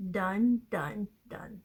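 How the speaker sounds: first "done" punched loud, then two quick "done"; emphatic repetition. Real, not acted. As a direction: stress each "done" as final.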